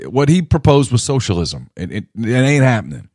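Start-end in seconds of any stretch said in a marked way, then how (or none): none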